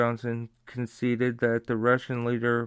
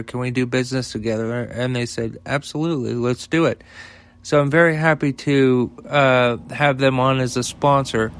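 ad read; 7 dB louder than usual. Acted. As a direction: sell it like a radio spot, leaning on the site name. Sponsor ad read.

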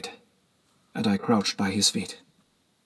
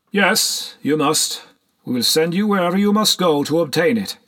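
high, emphatic assent; eager benefactor agreeing; 7 semitones higher than usual. A narrator's reading. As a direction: grant it grandly, pitched well up.

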